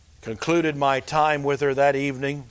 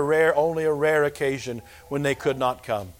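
normal talking voice

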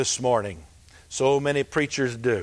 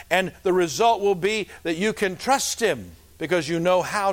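raised, driving sermon voice; preaching; 6 semitones higher than usual. Acted. Pastor exhorting his church, preaching hard. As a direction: preach it out to the congregation.

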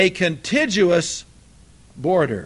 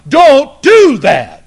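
slow, much louder and higher furious scream; screaming from hell; 6 semitones higher, 12 dB louder, much slower than usual. Acted. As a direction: bellow it as a damned soul warning. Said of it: "Hitler is screaming from hell".

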